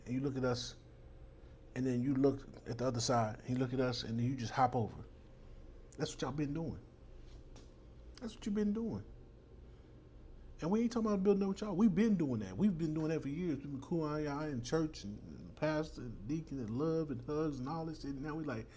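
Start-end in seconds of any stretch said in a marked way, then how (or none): none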